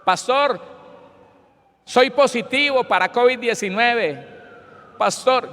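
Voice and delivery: high, loud mock-desperate plea; imitating a distraught caller; imitated desperate crying. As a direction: cry out the bad news, hammed up. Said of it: "llorando, desesperado".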